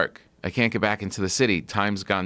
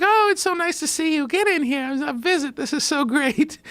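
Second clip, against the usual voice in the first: high-pitched granny impression; imitating Nana. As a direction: play the doting grandma pulling him in for a visit. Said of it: "And she's like".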